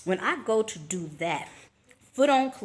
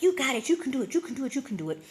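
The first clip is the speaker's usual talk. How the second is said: higher cheering "you can do it"; cheering auntie; 7 semitones higher, faster than usual. Retold or imitated. Auntie in the car cheering her on, higher excited chant. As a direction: egg her on from the passenger seat. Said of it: "she's like".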